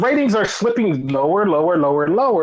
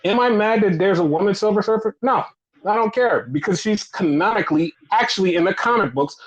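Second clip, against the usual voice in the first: ordinary talk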